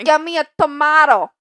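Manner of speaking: mock Brooklyn accent. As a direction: belt it out in a hammed-up Brooklyn accent. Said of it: "Maybe it's a Brooklyn thing".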